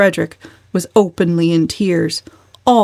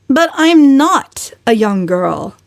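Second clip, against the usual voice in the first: put-on slow, raised retort from a young woman; defiant retort; 6 semitones higher, 4 dB louder, slower than usual. A narrator's reading. Valancy retorting to her aunt, defiant. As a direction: answer back firmly, unbowed. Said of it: "retorted Valancy, uncrushed".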